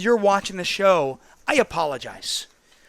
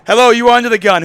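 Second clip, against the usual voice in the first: louder, higher on-air host hello; radio host greeting; 5 semitones higher, 10 dB louder, faster than usual. Acted. Host greeting a caller on the air, upbeat.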